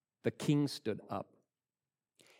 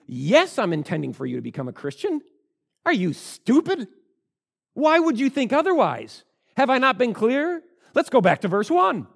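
high, loud incredulous shout; exasperated Paul; 11 semitones higher, 10 dB louder than usual. Acted. Paul shouting back at Agrippa, exasperated and loud. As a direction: fire back at him, fed up.

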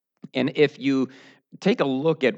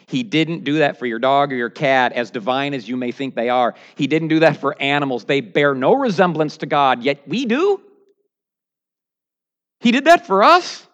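rising preaching; 5 dB louder than usual. Real, not acted. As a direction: build to a shout of triumph. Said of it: "preaching crescendo to climax".

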